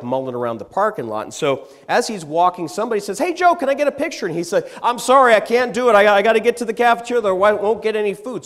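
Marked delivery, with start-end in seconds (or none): 3.2-4.3 high, loud fan call across the lot. Retold or imitated. Fan calls out to Joe for a picture, loud and quick. 4.8-8.4 loud, high, rushed apology; hurried brush-off. Retold or imitated. Joe rushing to the cafeteria waves off a fan, loud and hurried.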